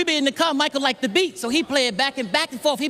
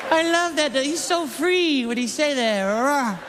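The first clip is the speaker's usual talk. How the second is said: lightly voiced Michael, higher, apologetic; higher sorry Michael owning his love of the song; sheepish Michael; 3 semitones higher, slower than usual. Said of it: "He said".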